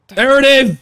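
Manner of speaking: loud, hyped yell; excited shout. Real, not acted. Reactor cheering the anime scene, loud excited shout. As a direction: cheer it out as the moment lands. Hype at the screen.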